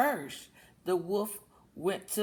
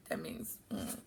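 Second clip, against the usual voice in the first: whispered explanation; 14 dB quieter than usual. Real, not acted. Explaining word, unvoiced.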